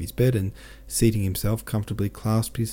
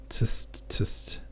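tongue clicking; 6 dB quieter than usual. Acted. Man clicks tongue in pity.

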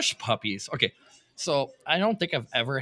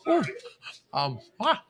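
burned tongue; 11 semitones higher, 6 dB louder than usual. Real, not acted. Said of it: "my tongue is burning".

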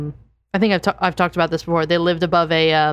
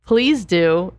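ordinary talk

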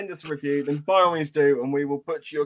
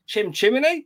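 higher mock-Cockney with a questioning lift; hammed-up Cockney, rising at the end; Mary Poppins sweep; 6 semitones higher, 4 dB louder, slower than usual. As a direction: say it like a musical chimney sweep, pitched up. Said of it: "Now say chim chimney".

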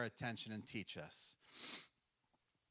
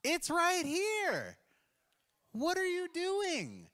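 much higher, louder playful divine scolding, slowing at the end; a bemused, exasperated God, stretching the last lines out; God's exasperated voice; 14 semitones higher, 5 dB louder, slower than usual. Retold or imitated. God looking at a stubborn believer, high-pitched exasperated scolding. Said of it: "God's looking at you like".

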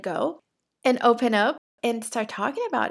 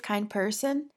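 usual speaking voice

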